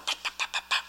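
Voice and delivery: soft, breathy pop pop pop. Shown as a demonstration. Showing the congregation a light spanking, soft pops. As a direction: tap out the spoon pops softly.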